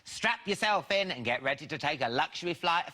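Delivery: talk-show host's big build-up; show announcer introduction. Acted. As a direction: announce the guest with a soaring finish. Host announces the guest.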